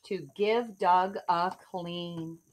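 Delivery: put-on lively rhyming read-aloud for kids; story narration. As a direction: read it like a bedtime picture book. Reads picture book aloud.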